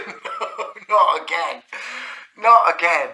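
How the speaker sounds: loud, breathy, laughing no; laughing refusal. Real, not acted. Pupil refusing the headmaster while laughing loud.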